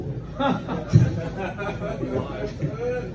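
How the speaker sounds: breathy built-up laugh that escapes; real laugh finally breaking free; bursting laugh. Real, not acted. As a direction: let the held laugh burst out. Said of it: "a laugh starts to build".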